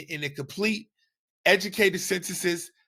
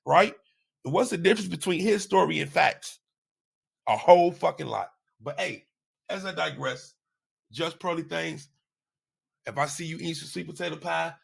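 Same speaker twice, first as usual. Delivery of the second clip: ordinary talk, nothing marked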